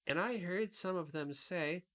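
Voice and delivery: put-on boy Tip. A narrator's reading. Tip speaks.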